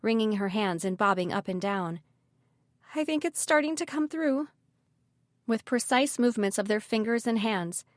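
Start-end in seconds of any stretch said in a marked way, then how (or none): 2.9-4.5 high-pitched worried voice; anxious alarm. A narrator's reading. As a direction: voice a nervous woman, pitched up. Anxious Callie in danger.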